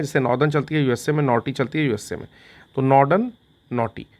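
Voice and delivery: normal talking voice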